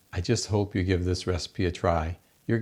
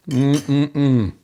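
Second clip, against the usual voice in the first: a louder, higher mm-mm-mm of delight; savoring hum; 4 semitones higher, 5 dB louder than usual. Real, not acted. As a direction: hum with pleasure as the taste lands. Tasting the sauerbraten.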